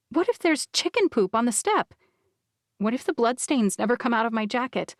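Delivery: ordinary delivery, nothing marked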